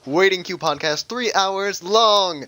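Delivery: showy podcast welcome, last words drawn out; mock announcer. Restarting the joke intro for co-hosts, hammed-up announcer. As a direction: announce it with big hype, stretch the ending.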